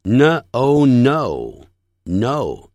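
very slow 呢, oh, joined into no; sound-by-sound blending. Shown as a demonstration. Teacher showing the easier way into no, slow and separated. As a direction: say 呢 and oh apart, then blend into no. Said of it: "这样念".

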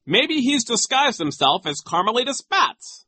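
very high, loud silly joke; high-pitched mocking official; Nero. A narrator's reading. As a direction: tease with pompous glee. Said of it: "making idiotic jokes".

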